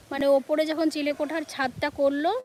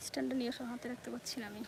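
hushed aside off camera; whispering; 2 semitones lower, 13 dB quieter, much faster than usual. Real, not acted. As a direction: whisper the request off mic. Off-mic aside to family.

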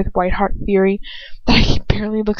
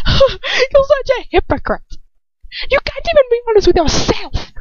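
deranged sneer. Acted. Dolokhov taunts Lucius, high deranged sneer. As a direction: sound unhinged. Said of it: "laughs again, quite deranged".

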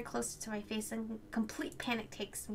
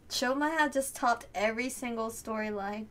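ordinary delivery

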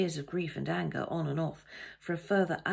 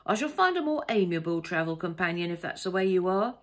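affronted, louder parting announcement; huffy male passenger; 2 semitones higher, 6 dB louder than usual. A narrator's reading. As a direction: take offended leave of a rude companion.

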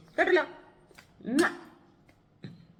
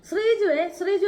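calling out; 8 semitones higher, 5 dB louder than usual. Real, not acted. Calling out to a viewer.

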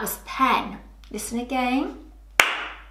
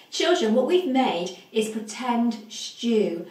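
normal talking voice

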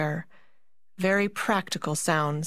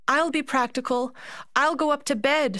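frightened; 7 semitones higher than usual. A narrator's reading. Marie frightened by Mouse King.

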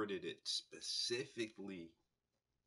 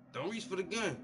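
raised warning to the cop; louder, urgent plea not to reach; man warns the officer, raised and urgent; firm warning; 8 semitones higher, 6 dB louder than usual. Retold or imitated. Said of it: "When the man say".

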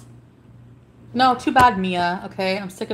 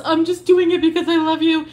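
mocking impression; 6 semitones higher than usual. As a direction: parrot her words back sarcastically. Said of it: "So all this".

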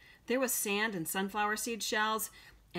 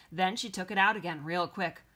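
plain reading voice

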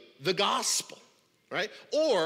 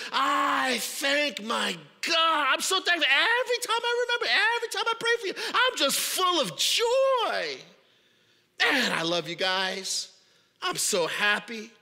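much higher, louder, overjoyed exclamation; gushing joy; 6 semitones higher, 3 dB louder than usual. Acted. Paul gushing thanks to the Philippians, overjoyed. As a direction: burst with happiness. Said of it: "very cheerful, very happy".

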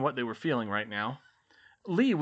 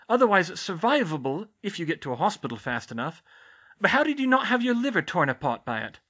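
higher, brisk fussing matron; brisk school nurse; 5 semitones higher, 6 dB louder than usual. Acted. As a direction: rattle off injuries like a nurse. School nurse explains the curse.

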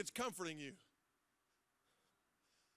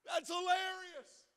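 ordinary delivery

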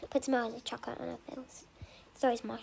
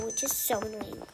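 normal talking voice

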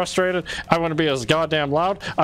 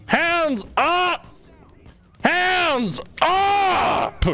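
real desperate shouting, building; 11 semitones higher, much slower than usual. Real, not acted. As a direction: yell for help, more frantic with each call. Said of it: "Don't you hear me screaming".

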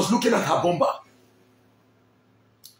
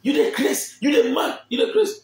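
high, shrill-pitched screaming insult; mock furious woman; 8 semitones higher than usual. Woman grabbing his shirt, high mock screaming. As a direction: scream it like she's losing it. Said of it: "the woman grabbed my shirt".

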